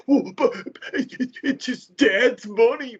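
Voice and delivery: high, slow, whiny objection; mock whiny critic. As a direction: whine it like a petty critic. Mimicking a dismissive critic.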